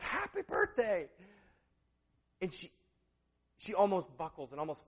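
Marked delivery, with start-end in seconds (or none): 0.0-1.1 higher, drawn-out party shout; slow celebratory holler; mock crowd cheer. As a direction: imitate a surprise-party crowd yelling. Crowd greets her surprise party.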